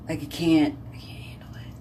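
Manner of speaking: unvoiced exasperated whisper; whispered I can't handle it. Real, not acted. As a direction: breathe it out, fed up. Exasperated aside.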